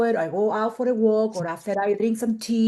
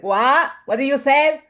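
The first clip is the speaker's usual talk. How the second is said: calling out; 4 semitones higher, 6 dB louder than usual. Retold or imitated. Friend calling from the shower.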